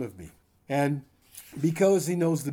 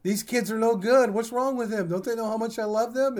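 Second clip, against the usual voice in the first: higher, hammed-up whining about the kids; self-pitying whine; 7 semitones higher than usual. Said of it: "Are you feeling sorry for yourself?".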